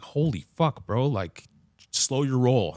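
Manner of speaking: loud alarmed shout at him; loud retold shout of alarm; startled yell. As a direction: yell it as he lunges at you. Startled yelling at attacking dad.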